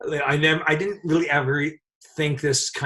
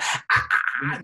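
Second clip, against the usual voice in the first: short, breathy mock screams; voiceless little shrieks, retold; imitated screams; slower than usual. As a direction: mimic two people screaming in shock. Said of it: "They screamed in each other's faces".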